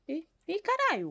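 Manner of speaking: sudden high yelp of fright; startled scream. Real, not acted. Streamer jolted by a jump scare, high startled cry. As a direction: jump out of your skin on the word.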